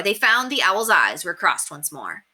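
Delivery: ordinary delivery, nothing marked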